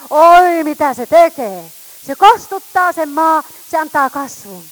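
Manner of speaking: fiery preacher calling down the word; full-force preaching. Acted. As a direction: proclaim it to the whole hall.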